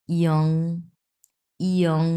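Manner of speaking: ইয়ং, each one a separate model with a glide; model pronunciation. Shown as a demonstration. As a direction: slide from i into ইয়ং, pausing between repeats. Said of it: "এটা হচ্ছে ইয়ং". (স্পষ্ট করে ইয়ং)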